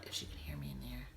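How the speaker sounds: hushed whisper, barely any voice; breathy aside whisper. Real, not acted. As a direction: whisper so she can't hear you. Checking if someone hears her.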